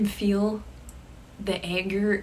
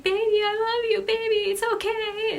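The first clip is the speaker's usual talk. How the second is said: high pleading call; pleading girlfriend; 14 semitones higher, 4 dB louder than usual. Retold or imitated. Tara calling after her boyfriend, high and pleading. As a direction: beg after him in a high voice. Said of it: "I could just hear her being like".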